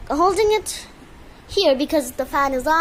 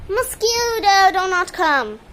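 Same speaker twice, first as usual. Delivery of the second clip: high, loud, slow call to the mosquito; loud warning; 5 semitones higher, 5 dB louder, slower than usual. Real, not acted. Owner shouting at a mosquito to stay away, loud. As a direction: warn the mosquito off loudly.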